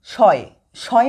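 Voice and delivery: "শয়" drawn out slowly to show the অয় sound; slow syllable demo. Shown as a demonstration. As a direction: stretch "শয়" to let অয় be heard. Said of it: "স, অয়". (ধীরে টেনে "শয়" উচ্চারণ)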